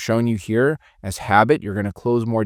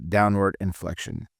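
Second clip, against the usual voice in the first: INFLECTION sinking lower at the end; downward inflection; 3 semitones lower, 4 dB quieter than usual. Shown as a demonstration. Trainer demonstrating the boss tone for sales reps, downward inflection. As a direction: drop the pitch on "inflection" like a boss. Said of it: "My tonality at the end when I'm talking goes down".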